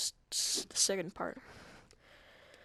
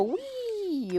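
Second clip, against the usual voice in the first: gleeful wee; 13 semitones higher than usual. Pretend. Mock joyride in the car.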